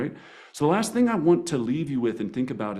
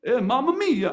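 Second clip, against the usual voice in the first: mock Italian exclamation, pitched way up and louder; Italian gusto; 10 semitones higher, 4 dB louder than usual. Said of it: "get straight Italian with them".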